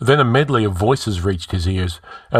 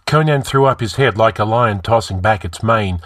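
plain reading voice

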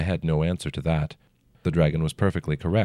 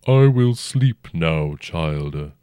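slow, rumbling ancient dragon; 5 dB louder, much slower than usual. A narrator's reading. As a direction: speak as a huge old dragon, unhurried. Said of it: "the dragon said".

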